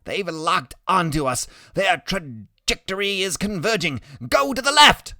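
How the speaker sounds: rising from tense report to loud high command; boy lookout growing frantic; urgent warning. Acted. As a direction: build to an alarmed order.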